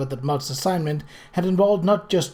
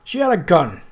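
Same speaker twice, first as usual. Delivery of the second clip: ordinary delivery, nothing marked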